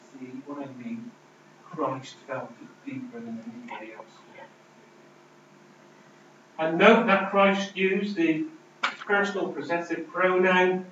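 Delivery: normal talking voice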